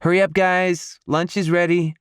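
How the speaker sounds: high, singing-out call from afar; shouting. A narrator's reading. Georgia calling the men in for lunch, shouting. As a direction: call the family in to eat. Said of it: "She shouts".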